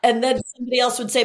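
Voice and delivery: usual speaking voice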